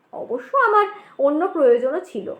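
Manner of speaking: usual speaking voice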